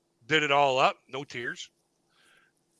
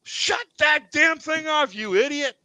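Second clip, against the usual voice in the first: higher, louder, slow yelled order; mock furious manager; 11 semitones higher, 5 dB louder, slower than usual. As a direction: bark it like an exasperated boss. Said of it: "and she's like".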